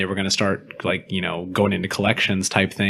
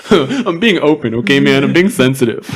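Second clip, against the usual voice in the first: laughing defensively; 6 semitones higher, 7 dB louder than usual. Real, not acted. Defends herself laughing at teasing.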